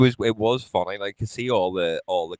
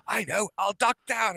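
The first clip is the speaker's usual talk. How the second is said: sneaky idea; 12 semitones higher, 4 dB quieter than usual. Retold or imitated. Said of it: "He goes".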